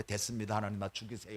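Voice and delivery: soft, low, worn-out surrender; despairing plea. Acted. Elijah hiding in the valley, begging God, soft and defeated.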